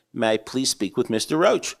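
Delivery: normal talking voice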